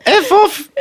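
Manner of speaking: high, loud Geordie brush-off; hammed-up Tyneside "F off"; squeaky cartoon Geordie dismissal; mock Geordie accent. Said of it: "our impression of Ridley Scott".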